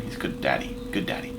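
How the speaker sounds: whispered softly, full of love; tender whisper. Real, not acted. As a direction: breathe it out fondly, barely voiced. Tender praise of his dad.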